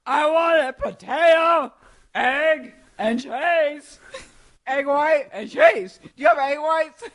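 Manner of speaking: mock yelling at a drive-thru intercom; mock shouting order. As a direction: bellow it at the speaker box, drawn out. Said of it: "screaming at the fucking, excuse me, intercom".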